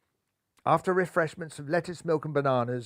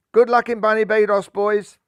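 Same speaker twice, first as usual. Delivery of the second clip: calling out; 5 semitones higher, 9 dB louder than usual. A narrator's reading. Farewell shout from the dolphins.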